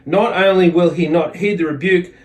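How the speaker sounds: ordinary talk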